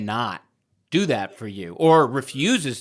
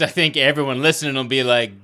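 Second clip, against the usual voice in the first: ordinary talk, nothing marked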